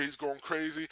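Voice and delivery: ordinary talk, nothing marked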